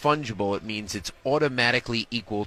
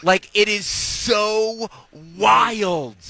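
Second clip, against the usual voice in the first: slow, much higher and louder disbelief; incredulous shout; 9 semitones higher, 7 dB louder, much slower than usual. Real, not acted. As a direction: blow up at how crazy it is. Exclaims over absurd NFT market.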